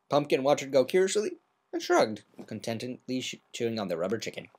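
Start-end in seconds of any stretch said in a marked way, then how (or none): none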